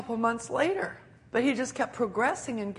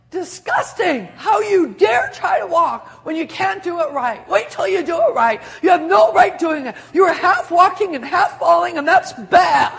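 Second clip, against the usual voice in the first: loud, high mock outrage at a toddler; mock scolding shout; 6 semitones higher, 11 dB louder than usual. A pretend parent scolding a toddler for falling, shouted in mock outrage.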